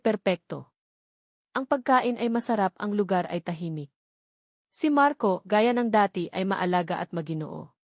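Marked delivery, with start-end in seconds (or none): none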